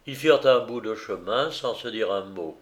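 ordinary delivery, nothing marked

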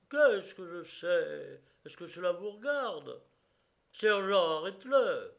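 retort, then shout the order; angry retort. A narrator's reading. The brigadier retorts and orders the sergeant to arrest him, angrily.

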